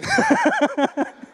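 a shrill burst of laughter; high laugh. Real, not acted. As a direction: crack up high and loud. After the startled answer. (carcajada aguda)

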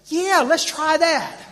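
high, loud, slow and drawn out; pointed retort. Retold or imitated. Imitating Mark answering him at the table, pointed. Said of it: "Like that".

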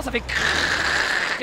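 slow unvoiced crrrr like metal grinding; scraping noise. Gamer mimicking his trailer scraping a car, drawn-out crrrr. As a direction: rasp out a scraping trailer sound.

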